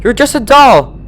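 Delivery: high, loud terrified scream. A narrator's reading. Twilight screams at the doll, terrified.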